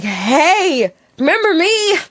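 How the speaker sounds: high, hopeful awkward greeting; squeaky high remember me; awkward opener. Retold or imitated. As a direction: try too hard to sound casual. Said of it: "This is my moment".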